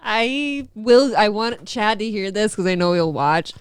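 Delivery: ordinary delivery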